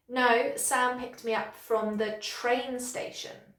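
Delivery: slow, with "train station" stressed as the correction; weight on "train station" to fix the mistake; corrective stress. Shown as a demonstration. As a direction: correct the place by punching "train". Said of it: "use sentence stress to correct somebody".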